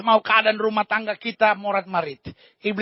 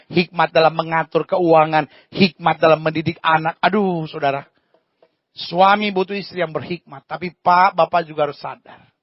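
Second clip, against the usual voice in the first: ordinary talk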